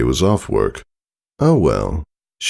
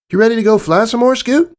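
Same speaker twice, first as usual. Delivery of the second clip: high, quick filly voice; chirpy little filly eager to fly; young Apple Bloom; 4 dB louder, faster than usual. A narrator's reading. Apple Bloom asking Scootaloo to go flying, high and quick.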